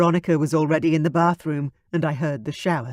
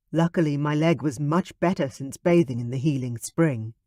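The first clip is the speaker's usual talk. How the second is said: usual speaking voice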